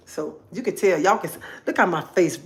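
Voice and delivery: usual speaking voice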